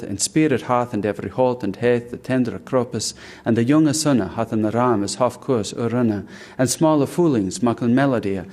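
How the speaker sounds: Juan de Rapro recited in Chaucer's Middle English sounds; Middle English recital. Shown as a demonstration. Teacher reciting Canterbury Tales opening in Middle English. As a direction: recite it like Chaucer read aloud. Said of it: "to just hear the rest of those lines".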